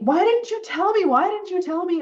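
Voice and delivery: high, loud indignant complaint; a hammed-up teenager demanding answers; retold anger. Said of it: "I'm so angry now".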